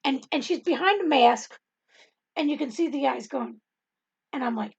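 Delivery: ordinary delivery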